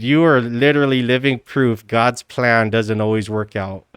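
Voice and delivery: usual speaking voice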